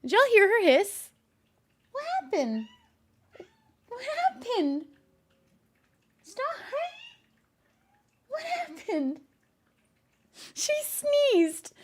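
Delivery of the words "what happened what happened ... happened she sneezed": pet talk. Real, not acted. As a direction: coo over the cat, amused. Coos to cat after hiss.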